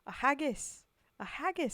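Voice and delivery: loud, high cry of "A haggis!" in Scottish brogue; excited Scottish accent. Pretend. Streamer crying out the poem line to chat in a loud, high Scottish accent. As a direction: shout it out like a delighted Scot. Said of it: "I have an attempt at an accent".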